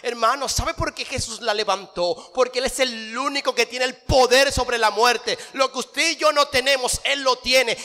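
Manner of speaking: triumphant sermon close; a soaring pulpit finale; rising preaching. Acted. Closing preaching.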